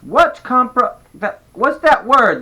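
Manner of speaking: put-on high, loud, slow child question; little boy voice. A narrator's reading. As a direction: puzzle over it like a small kid. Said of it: "the pain asked".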